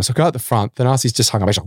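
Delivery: ordinary delivery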